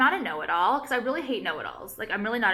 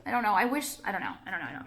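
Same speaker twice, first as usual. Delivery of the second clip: normal talking voice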